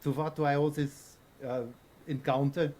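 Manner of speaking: halting, put-on German accent; formal courtesy in a German accent. Coach receives his student, courteous German accent. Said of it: "Oh, I like the German".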